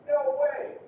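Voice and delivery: "No way!" high from start to finish; high intonation. Shown as a demonstration. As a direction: keep both words of "No way!" high. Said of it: "finishes high".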